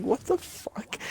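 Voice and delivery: high, incredulous what the fuck; stunned, voice jumping up; shocked disbelief. Retold or imitated. Teen reacting to the woman calling 911, shocked.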